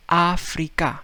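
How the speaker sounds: put-on "Africa" said ahf-ree-kah in a Spanish accent. Teacher imitating Spaniard, in a Spanish accent. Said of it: "he's saying".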